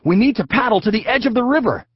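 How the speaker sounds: put-on high, loud, fast boy voice; rushing out the plan; high hurried kid voice; Zach urgent. A narrator's reading. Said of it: "said Zach".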